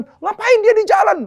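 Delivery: high, loud, alarmed question; frantic outburst, acted out; retold panic. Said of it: "udah panik".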